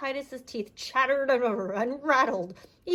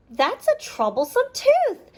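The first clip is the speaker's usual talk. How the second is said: much higher clucky hen; 13 semitones higher, 4 dB louder than usual. Acted. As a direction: perch up high like a fussy hen. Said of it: "said Sadie the hen".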